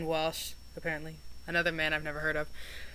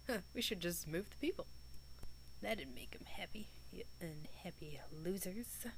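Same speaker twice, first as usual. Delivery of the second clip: mocking whisper; 16 dB quieter than usual. Mocking aside to the characters.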